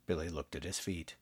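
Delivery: ordinary delivery, nothing marked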